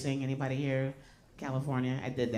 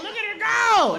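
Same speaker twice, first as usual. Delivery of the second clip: mock cheer; 11 semitones higher, 7 dB louder than usual. Men cheering her on.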